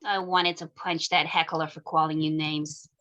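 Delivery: soft, low lovers' talk; tender flirting. Acted. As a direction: murmur it close to her. Lovers flirting after the game.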